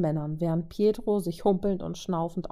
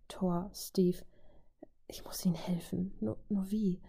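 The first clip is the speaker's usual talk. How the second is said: softer, voiceless, anxious whisper; a panicked whisper she cannot say aloud; desperate whisper; 8 dB quieter than usual. Acted. Vajo, frozen, frets to herself.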